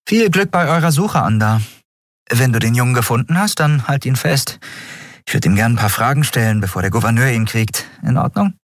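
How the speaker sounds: brisk farewell with a request; high character voice. A narrator's reading. Narrator voices farewell dialogue. (hohe Figurenstimme, Abschied mit Bitte)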